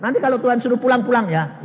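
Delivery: high, fast, fed-up no; annoyed sister cutting him off fast; irritated refusal. Retold or imitated. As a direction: brush him off quickly. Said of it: "Jengkel, dia marah".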